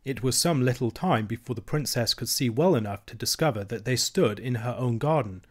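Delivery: ordinary delivery, nothing marked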